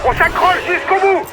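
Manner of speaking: barking gym coach. Acted. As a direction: yell the rider on to the line. Team director yelling rider to finish.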